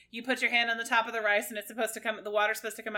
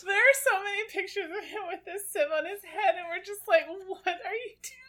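laughing while talking; 10 semitones higher than usual. Real, not acted. Laughing at brother's sieve pictures.

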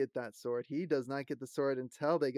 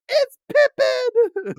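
drawn-out, high, laughing shout of delight; excited recognition; 14 dB louder, much slower than usual. Retold or imitated. Host reliving the moment she spotted Pippin's actor, squealing. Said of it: "I was like".

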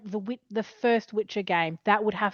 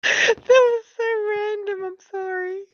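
laughing; 13 semitones higher, 5 dB louder than usual. Real, not acted. Laughing hard at random joke.

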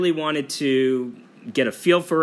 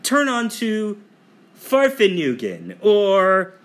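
lightly imitated navigation voice, higher pitched; GPS assistant voice; 6 semitones higher, slower than usual. Traveler mimicking the GPS in Iceland, mock navigation voice. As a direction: read out mangled foreign street names like a GPS. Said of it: "whether it's Siri or Alexa, they would come on and say".

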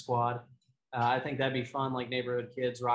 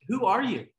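freaked-out demand; 8 semitones higher, 7 dB louder than usual. Retold or imitated. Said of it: "And she's freaking out".

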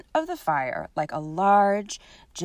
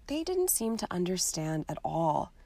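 usual speaking voice

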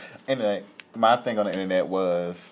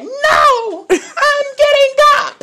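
loud, very high drawn-out mock scream; imitating shrieked defiance; 9 dB louder, much slower than usual. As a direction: shriek your refusal as the stubborn woman. Acted tased woman still resisting.

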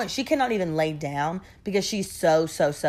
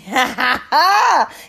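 loud, high burst of laughter; incredulous laugh; 6 semitones higher, 7 dB louder than usual. Real, not acted. Laughing in disbelief at his casual greeting. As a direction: laugh at how absurd he is.

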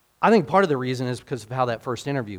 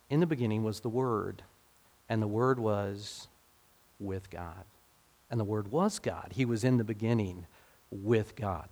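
solemn scripture reading; 3 semitones lower, 8 dB quieter than usual. Acted. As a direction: let each phrase of scripture settle.

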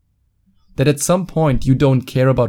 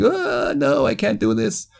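very high, whimpering mock whine; high, soft mock whimper of a quitter; whiny quitter; 9 semitones higher, 3 dB quieter than usual. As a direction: whimper it like a boy giving up. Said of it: "go like".

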